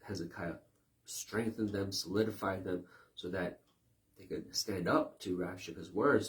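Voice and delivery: normal talking voice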